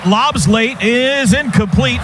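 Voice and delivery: ordinary delivery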